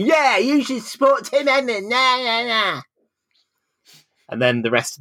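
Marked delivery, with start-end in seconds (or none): 0.1-2.8 very high, sing-song jeer; mock taunting wife. The man's partner joining the taunting, hammed-up jeer. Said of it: "this guy's wife part, slash partner starts joining".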